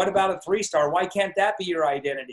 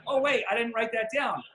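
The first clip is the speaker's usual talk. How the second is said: usual speaking voice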